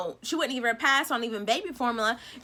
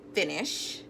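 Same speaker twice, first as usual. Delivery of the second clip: ordinary talk, nothing marked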